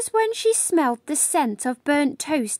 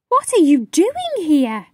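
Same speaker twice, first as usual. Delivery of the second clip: louder, higher shocked demand; startled question; 4 semitones higher, 6 dB louder than usual. Acted. Madame Miraculous demanding why her mother came, loud and startled. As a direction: caught off guard by the visitor.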